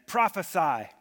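a loud, jeering "Prophesy!"; mocking shout. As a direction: bark it out like a taunting guard. Guards mocking Jesus, striking him.

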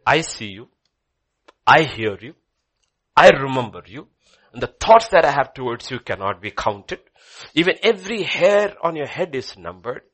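loud, ringing declarations of love; preaching at full force. Acted. Preacher as God reassuring the lonely, loud ringing proclamation. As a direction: proclaim it to the whole church.

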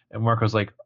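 normal talking voice

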